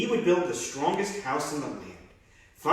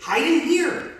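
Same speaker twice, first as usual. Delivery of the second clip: high, loud urgent call; crying out; 14 semitones higher, 7 dB louder, slower than usual. Acted. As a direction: urge the brothers inside loudly. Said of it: "cried the third little pig".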